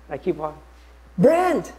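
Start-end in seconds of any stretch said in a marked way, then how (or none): 1.2-1.7 loud high call of a name. Retold or imitated. Old schoolmate shouting his name from a cab.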